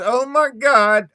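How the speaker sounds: high, loud burst of recognition; surprised exclamation. Retold or imitated. Irish stranger recognizing Mom in motel lobby, loud surprised cry. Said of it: "and he says".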